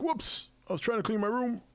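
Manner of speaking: startled friend caught listening; imagined startled reply. Pretend. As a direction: blurt it like someone caught out. Imagined James caught off guard.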